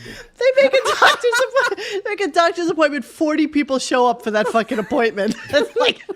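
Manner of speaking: talking through laughter; laughing. Real, not acted. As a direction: laugh while you talk.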